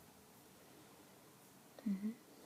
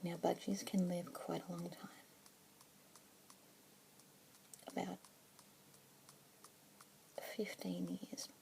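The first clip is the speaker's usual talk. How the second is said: soft unvoiced whisper throughout; asmr whisper; 7 dB quieter than usual. Keeper introducing a budgie to a listener, whispered. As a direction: whisper gently, close to the mic.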